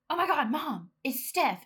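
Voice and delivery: gasp, then high, loud excited blurt; fangirling. Retold or imitated. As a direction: reenact a starstruck fan alerting mom. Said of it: "like, fangirling".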